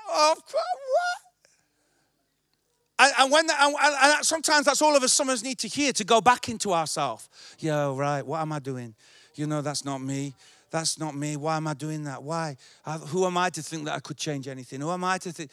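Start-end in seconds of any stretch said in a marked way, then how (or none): 0.1-1.3 high, startled cry of disbelief; mock shock. Pastor playing a stunned friend, high disbelieving cry. 7.6-15.5 low, soft muttering to yourself; inner self-doubt. Retold or imitated. Pastor voicing a believer's doubts, low and soft.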